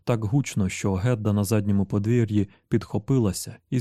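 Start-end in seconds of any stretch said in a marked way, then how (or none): none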